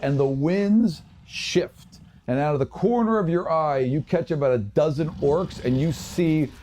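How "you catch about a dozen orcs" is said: usual speaking voice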